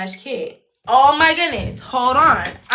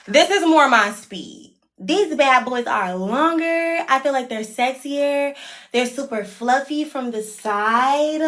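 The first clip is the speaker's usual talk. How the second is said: usual speaking voice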